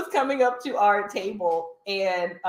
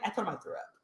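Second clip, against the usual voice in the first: normal talking voice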